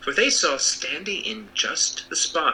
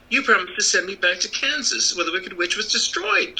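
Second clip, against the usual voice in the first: put-on little girl voice; 7 semitones higher than usual. A narrator's reading. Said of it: "said the girl".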